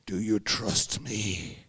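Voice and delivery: slow, deep, menacing Russian drawl; heavy Russian menace, drawn out; Russian man's question. Retold or imitated. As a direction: loom over him and ask it slowly. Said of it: "the Russian man says to me".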